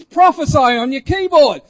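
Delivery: loud, high retold order; commanding. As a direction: call out the order forcefully. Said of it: "and with a command".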